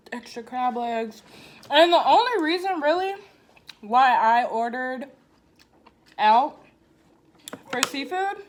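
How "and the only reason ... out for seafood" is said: louder, pressing the point; emphatic. Real, not acted. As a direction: drive the point home, emphatic. Emphatic rant about seafood.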